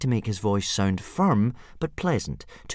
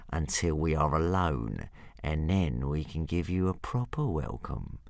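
menacing purr; 4 semitones lower, 5 dB quieter than usual. A narrator's reading. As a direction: purr it like a big cat. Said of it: "purred Hook Fang menacingly".

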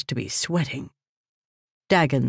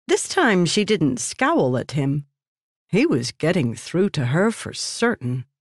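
usual speaking voice

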